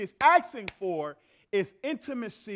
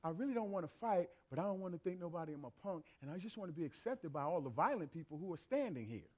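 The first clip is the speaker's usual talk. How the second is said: inner voice; 5 semitones lower, 11 dB quieter than usual. Retold or imitated. Inner voice of scared man.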